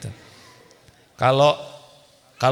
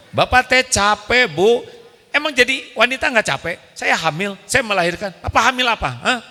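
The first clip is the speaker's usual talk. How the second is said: high, fast indignant wife; mock angry wife rant; 9 semitones higher than usual. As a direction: fire it back at the husband. Wife's angry rant acted.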